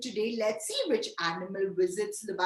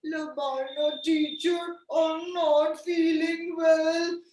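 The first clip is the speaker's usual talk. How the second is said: slow, wailing pretend bird; high, slow, pitiful owl voice; sick owl character; 5 semitones higher, slower than usual. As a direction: complain as an ailing owl, raised and drawn-out. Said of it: "and it was a bird".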